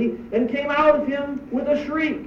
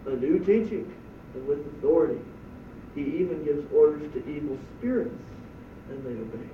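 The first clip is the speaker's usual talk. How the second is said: low, soft, fading wonder; the crowd can only murmur; hushed amazement; 8 semitones lower, 8 dB quieter than usual. Pretend. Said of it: "All they can say is".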